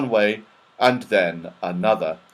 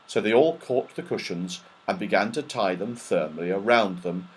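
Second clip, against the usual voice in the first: ordinary delivery, nothing marked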